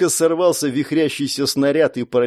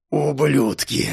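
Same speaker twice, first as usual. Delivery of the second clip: growled curse; slower than usual. Acted. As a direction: snarl it out at the enemy. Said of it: "прорычал он".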